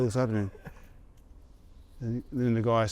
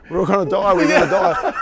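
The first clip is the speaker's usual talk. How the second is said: loud, high mock panic, laughing through it; retold screaming; 9 semitones higher, 8 dB louder than usual. Teller mimics panicked mate on a nosediving plane, mock screaming. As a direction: yell it like the plane is going down. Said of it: "The Tarsus was screaming".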